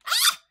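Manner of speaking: high sudden startled yelp; startled scream. Real, not acted. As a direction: jump and shriek in fright. Startled by the other player.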